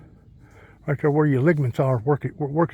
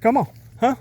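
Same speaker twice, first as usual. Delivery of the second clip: high playful coax to the dog; 9 semitones higher than usual. Real, not acted. Man coaxes his dog Jack, high and playful. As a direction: sweet-talk the dog into coming.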